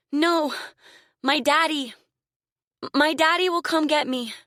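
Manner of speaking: put-on high, loud pleading child's voice; cling to hope of daddy; frightened little girl. A narrator's reading. Terrified girl insists.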